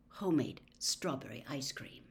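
usual speaking voice

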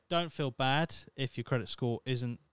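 ordinary talk, nothing marked

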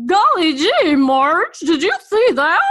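high, loud, gee-whiz neighbor gushing; hammed-up wholesome fifties small talk across the driveway. Mock fifties neighbor calling to Marge over the driveway, hammed-up excitement. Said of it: "back to the fifties where".

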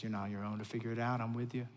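soft, tender divine reassurance; gentle voice of God. Acted. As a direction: speak as God quietly comforting a worried child. God answers the worried worker.

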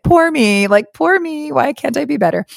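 high, hammed-up poor-me whine; mock self-pity. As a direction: pity yourself theatrically, tongue in cheek. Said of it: "this is the whole, like".

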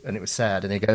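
normal talking voice

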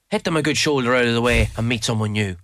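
ordinary talk

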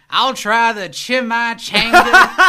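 high, loud, slow hammed-up diner voice with laughter; mocking family ordering. Podcaster imitating her family at a Mexican restaurant, loud laughing parody. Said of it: "That's not really how my family talks".

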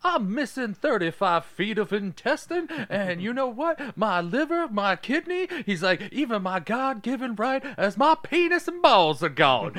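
high, showy villain drawl; flamboyant. Retold or imitated. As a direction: ham it up flamboyantly. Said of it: "very flamboyant about it".

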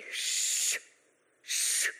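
hushed crowd telling someone off; low, unvoiced shushing. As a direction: shush sharply, then warn in a low hush. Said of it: "Og så sier de".

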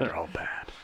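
soft, whispered agreement; whispered echo. Real, not acted. Co-host echoing that they're all bad, whispered. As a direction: echo it under your breath. Echoes the co-host's verdict.

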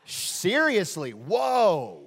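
loud, drawn-out mock astonishment; slow, overdone fake surprise; feigned naive. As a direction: play dumb with exaggerated concern. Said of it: "act really, really naive".

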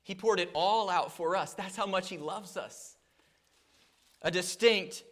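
fervent sermon delivery, rising and falling; preaching. Acted. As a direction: preach it out to the congregation.

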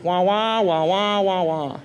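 Charlie Brown teacher wah wah; muffled trombone-like wah wah sounds; muffled wah wah talk. Retold or imitated. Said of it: "I sound like Charlie Brown's school teacher".

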